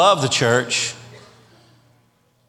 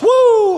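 siren whoop; 7 dB louder than usual. Retold or imitated. Cop lights come on.

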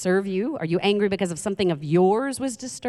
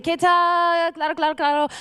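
higher, chatty put-on Spanish accent; a higher mock Spanish patter; fake Spanish; 9 semitones higher than usual. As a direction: bluff your way through in Spanish. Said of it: "take on the accent".

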